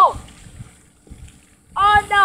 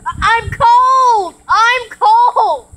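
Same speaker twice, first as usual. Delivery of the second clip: wailing; 4 semitones higher, 7 dB louder, much slower than usual. Real, not acted. Shivering, shouting at the cold.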